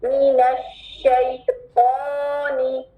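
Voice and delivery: drawn-out शैतानी; slow recitation. Shown as a demonstration. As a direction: stretch शैतानी. Recitation demo. (धीमी तिलावत)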